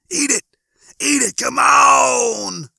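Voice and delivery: very high, loud squeaky urging; insistent hunger pain. A narrator's reading. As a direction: squeal it, building to a long loud plea. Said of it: "but it was fairly insistent".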